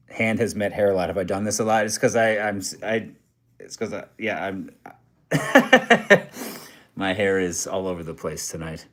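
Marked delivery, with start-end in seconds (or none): 5.3-6.6 sudden high-pitched laugh. Real, not acted. Embarrassed about messy hair.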